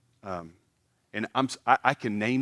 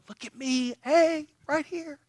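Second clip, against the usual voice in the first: much higher, slow mock show-off call; showoff voice. Preacher mocking a show-off seeking attention, high and hammy. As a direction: ham up a braggart begging for attention. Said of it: "when you're tempted to show".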